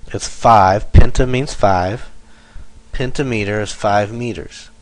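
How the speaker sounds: ordinary talk, nothing marked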